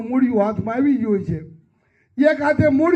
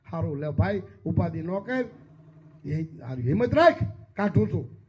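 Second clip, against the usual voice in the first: gentle reassurance; 6 semitones lower, 9 dB quieter than usual. Acted. Holy man comforts man in well.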